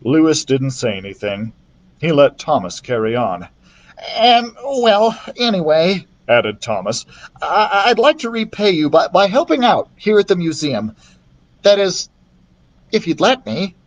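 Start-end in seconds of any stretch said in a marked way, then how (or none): 4.1-13.7 put-on raised boy voice, earnest apology; lightly voiced young thief saying sorry; Thomas's voice. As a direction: apologise with real remorse. Thomas offering to help.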